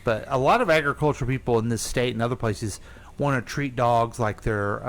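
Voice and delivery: normal talking voice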